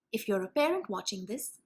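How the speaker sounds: normal talking voice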